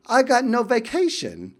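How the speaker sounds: playful Essex impression; mock Essex accent. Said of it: "that awesome English accent".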